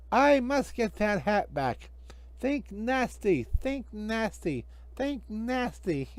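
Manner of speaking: high, slow, hushed villain voice; scheming villain. Acted. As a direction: brood it out slowly and slyly.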